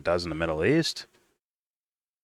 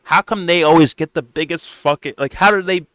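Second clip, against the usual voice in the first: higher, louder put-on voice of an exasperated ranter; mock exasperated ranter; 10 semitones higher, 7 dB louder than usual. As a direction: play an outraged conspiracy ranter. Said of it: "And it's like".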